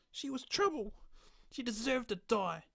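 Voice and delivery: high, loud unhinged voice; unhinged killer. Acted. Stranger revealing himself as the killer, unhinged. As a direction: snap into the killer's madness.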